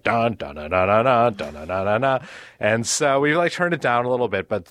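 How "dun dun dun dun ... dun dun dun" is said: mock ominous dun dun theme hummed; hummed scary music. Daughter mimics the scary show music from the stairs, hummed dun dun. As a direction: hum the scary TV score like a kid mocking it. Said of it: "that scary music".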